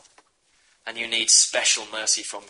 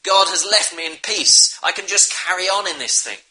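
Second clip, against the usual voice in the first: high, louder mock of a shrugging sinner; careless excuse; 7 semitones higher, 4 dB louder than usual. Preacher mocking a careless believer, shrugging. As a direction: brush off the sin lightly, higher pitched. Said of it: "We shouldn't say".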